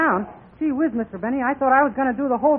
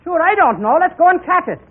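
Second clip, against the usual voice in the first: Irish character voice; 5 semitones higher, 6 dB louder than usual. Acted. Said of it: "So Mike said".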